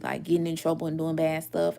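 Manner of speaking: ordinary talk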